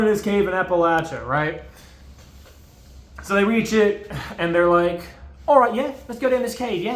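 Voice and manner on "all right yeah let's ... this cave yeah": higher put-on British accent; cheery British cavers in a hammed-up British accent; playful mock British accent. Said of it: "I'm gonna stop doing that".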